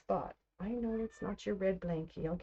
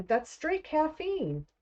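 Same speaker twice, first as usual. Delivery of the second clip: too much caffeine; 10 semitones higher, 3 dB louder, slower than usual. Real, not acted. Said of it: "I am feeling jittery again".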